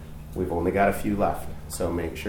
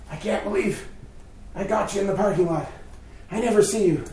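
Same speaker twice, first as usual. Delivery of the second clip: higher, gushing imitation of the lady; excited lady; 9 semitones higher than usual. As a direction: play up her delight at spotting him. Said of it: "and she goes".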